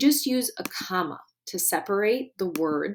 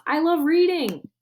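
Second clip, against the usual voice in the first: excited shout, much higher and louder; yelling; 9 semitones higher, 6 dB louder than usual. Acted. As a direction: shout it out with joy. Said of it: "Gartsky yells".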